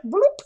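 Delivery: high popping "bloop" sound effect; quick high-pitched bloop noise; imitated bloop. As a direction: drop in a high little cartoonish bloop. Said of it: "he's just like".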